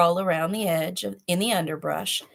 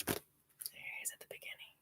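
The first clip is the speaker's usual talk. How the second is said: whispered aside; 22 dB quieter than usual. Real, not acted. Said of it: "whispered aside to listeners".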